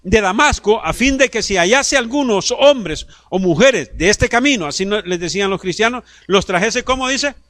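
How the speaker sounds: high, loud pulpit preaching; full-force sermon voice; fiery preaching. Acted. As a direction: preach it out to the whole church.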